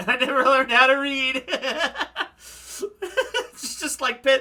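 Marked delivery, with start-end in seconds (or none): 0.1-1.4 laughing through the line; can't get the words out for giggling; real laughter breaking in. Real, not acted. Laughing while reading line. 1.5-3.5 high laugh. Real, not acted. Laughs after own line.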